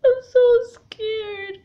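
high, loud, slow wail; real tearful outburst, drawn out; crying. Real, not acted. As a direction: cry it out in real fear. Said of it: "I'm sorry for crying".

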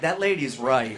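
low, soft man's voice; a low deadpan bystander. Retold or imitated.

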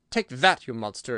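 angry cry. Acted. Sawhorse kicking the Woozy, angry cry. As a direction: hit the first words hard in anger. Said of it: "it cried angrily".

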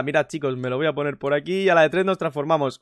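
ordinary talk

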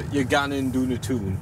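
"gone and doing a tune" in a Geordie accent; Geordie phrase. Shown as a demonstration. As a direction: teach it in a broad Geordie accent. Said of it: "say you're going out for a drink".

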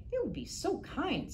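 high, grateful little voice; a tiny fish thanking the girl. Acted. Goldfish voiced by reader.

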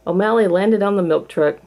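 plain reading voice

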